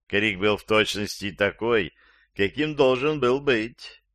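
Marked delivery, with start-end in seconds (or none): none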